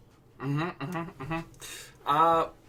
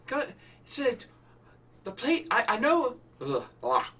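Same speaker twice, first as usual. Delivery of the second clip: Irish accent; 8 semitones higher than usual. Shown as a demonstration. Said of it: "The resonance is, like, way forward".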